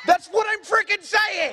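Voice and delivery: high, exasperated yell; exasperated outburst. Real, not acted. Podcaster protesting to audience pronouncer, exasperated shout. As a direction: cry out in comic frustration.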